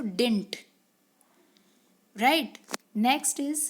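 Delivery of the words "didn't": "Didn't" said as one clipped word; fuse "Didn't" into a single word; contraction. Shown as a demonstration. Teacher giving the short form to students, contracted. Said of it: "क्या pronounce करेंगे जब हम इसको ऐसे लिखेंगे".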